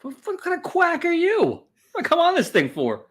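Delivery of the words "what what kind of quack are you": high, jokey outraged jab; squeaky mock-affronted demand; mock indignation. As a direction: pretend to be offended.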